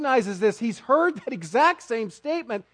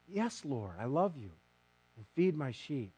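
quiet hurt reply; 7 semitones lower, 10 dB quieter than usual. Acted. Hurt Peter answers Jesus quietly.

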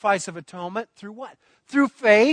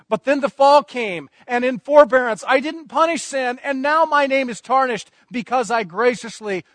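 raised, steady preaching as the voice from heaven; preached voice of God; 4 semitones higher, 6 dB louder than usual. Acted. Preacher speaking as God from heaven to the crowd, raised and fervent.

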